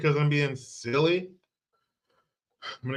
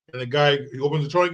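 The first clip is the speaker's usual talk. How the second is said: usual speaking voice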